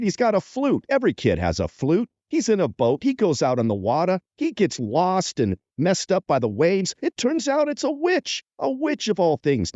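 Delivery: high, swooping New York pitchman; Sid and Marty Krofft, New York accent. Acted. Stoned producers improvise a show pitch, punchy New York accent. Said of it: "everyone had a New York accent".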